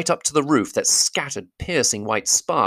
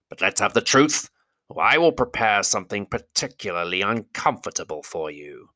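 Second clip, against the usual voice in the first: great goblin voice. Acted. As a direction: threaten him calmly like a goblin king.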